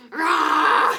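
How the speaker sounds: big playful lion roar, loud and high. Shown as a demonstration. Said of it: "You can make a lion sound".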